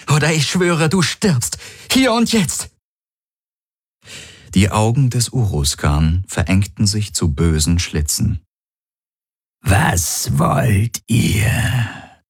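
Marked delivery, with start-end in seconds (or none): 0.1-2.7 high, sharp rising threat; furious threat. Acted. Threat with laser aimed. 9.6-12.2 very slow, eerie monster voice; menacing creature. Acted. Monster asks, eyes narrowed.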